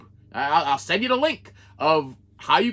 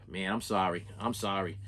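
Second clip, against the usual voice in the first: low, soft apology after a sigh; sigh, then sink into a quiet, sorry tone; sorry sympathy; 7 semitones lower, 8 dB quieter than usual. Retold or imitated. Vlogger feeling for a scammed collector, low sorry sympathy.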